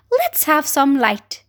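plain reading voice

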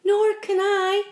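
high, slow glowworm wail; long-drawn plaintive cry. A narrator's reading. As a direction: wail it out helplessly. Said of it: "wailed the glowworm".

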